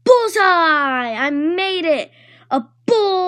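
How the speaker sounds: high, thrilled cry of triumph; excited holler. Acted. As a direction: cheer like you just won. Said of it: "I hollered really excitedly".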